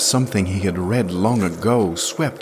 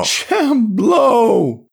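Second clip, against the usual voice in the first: shocked cry; 7 dB louder than usual. A narrator's reading. Yarrell shocked by the name.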